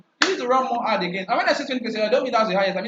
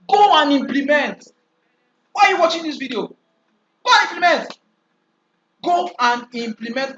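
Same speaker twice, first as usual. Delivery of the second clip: raised, pushing voice; emphatic urging; 6 semitones higher, 5 dB louder than usual. Real, not acted. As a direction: urge them to go act now. Urging viewers to act now.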